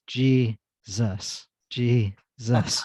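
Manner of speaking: earnest cry of Jesus while running; praying aloud. Retold or imitated. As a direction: call his name mid-stride. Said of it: "I was".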